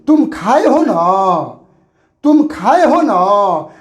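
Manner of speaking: slow question with a drawn-out "ना"; questioning tag. Shown as a demonstration. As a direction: ask it slowly, stretching the final "ना". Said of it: "हम आपसे पूछ रहे हैं".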